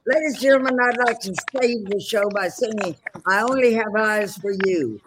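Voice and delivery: hammed-up showman's announcement, easing off at the end; stage announcer. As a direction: imitate a performer addressing the whole house. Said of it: "he'd run out on stage and go".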